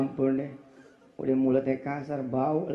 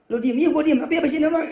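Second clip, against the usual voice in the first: loud high nagging; shrill mock nag at the husband; nagging wife; 12 semitones higher, 9 dB louder, faster than usual. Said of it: "Lu, Allah". (istri cerewet mengomel keras dan tinggi)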